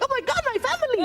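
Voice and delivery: very high squeal of mock amazement; hammed-up, high-pitched delight; overjoyed recognition. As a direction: squeal it as if spotting long-lost relatives. Acted joy at seeing lookalikes.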